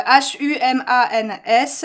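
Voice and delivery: slow, careful letter-by-letter spelling. Real, not acted. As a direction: spell each letter slowly and clearly. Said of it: "donc je vais vous l'épi".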